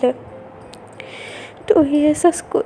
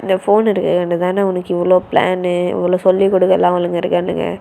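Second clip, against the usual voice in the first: angry brother on the phone; 6 semitones lower than usual. Acted. Brother scolding his sister's friends over the phone, low and angry. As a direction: warn the friends off, fast and firm.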